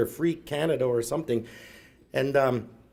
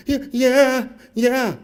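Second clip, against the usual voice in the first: put-on high, loud child's whimpering yes; hammed-up child voice; kid answers; 11 semitones higher, 6 dB louder, much slower than usual.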